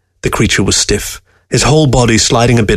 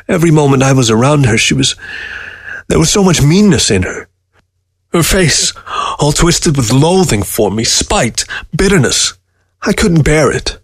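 higher, pleading, near tears; anguished pleading; 5 semitones higher than usual. A narrator's reading. Newberg pleading with Fox about his dying wife, anguished. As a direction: let the grief push the voice up.

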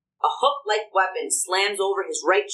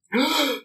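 sharp gasp; 7 semitones higher than usual. Acted. Poltergeist grabs the orb.

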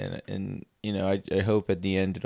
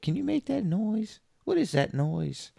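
put-on kid voice; 13 semitones higher than usual. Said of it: "they love it".

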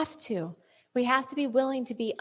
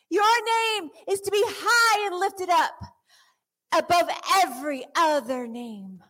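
high, loud fervent praise cried out; building worship cry; impassioned worship; 9 semitones higher, 4 dB louder than usual. Acted. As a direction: pour it out to Jesus at full voice. Preaching worship at full force.

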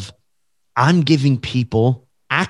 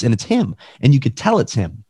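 ordinary talk

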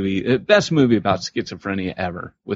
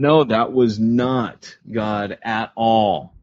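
slow emphatic denial; much slower than usual. Real, not acted. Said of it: "emphatic denial".

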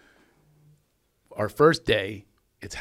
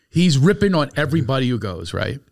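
normal talking voice

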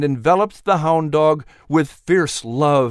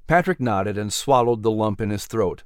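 ordinary delivery

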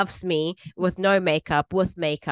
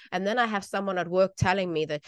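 ordinary talk, nothing marked

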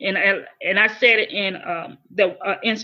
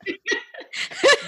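a sudden high laugh catching in the throat; high teary laugh. Real, not acted. Recalling how she never gave up, high tearful laugh. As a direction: laugh it off through rising tears.

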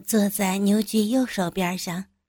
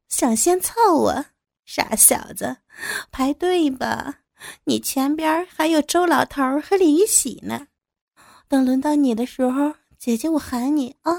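higher, teasing, with a sneering laugh; mocking flirt; 6 semitones higher than usual. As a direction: taunt him coyly from above. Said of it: "冷笑着冲他飞了个媚眼儿".

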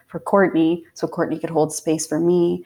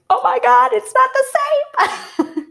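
much higher, slow astonished cry; shocked surprise; 4 dB louder than usual. Retold or imitated. Mother stunned when her water breaks, high surprise. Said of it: "that was when I was like".

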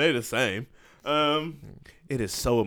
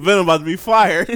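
laughing; 7 semitones higher, 9 dB louder than usual. Retold or imitated. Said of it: "I'm like".

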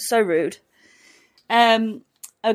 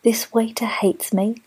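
whisper; slower than usual. Real, not acted. Whispering about the rude waiter.

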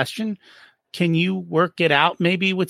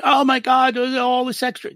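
mock freak-out; 7 semitones higher than usual. Bookkeeper freaking out about extra accounts, in mock panic. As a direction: play a bookkeeper panicking over the extra work. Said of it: "People freak out".

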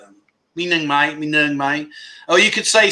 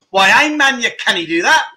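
loud, higher burst of mock Geordie; Geordie accent; 5 semitones higher, 3 dB louder than usual. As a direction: have a go at a broad Geordie accent. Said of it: "Geordie accent".

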